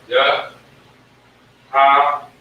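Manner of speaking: Yah-ha-wa-da said very slowly, syllables split; teacher spelling out the name. Shown as a demonstration. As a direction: break Yahawada into syllables. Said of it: "So you write that".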